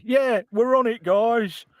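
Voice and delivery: high, wooden actor's line read; hammed-up bad line reading; badly delivered line. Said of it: "line is delivered really badly".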